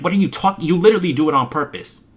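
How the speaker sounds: high, loud exasperated outburst. Real, not acted. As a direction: snap in disbelief at the trolls. Ranting at trolls in chat.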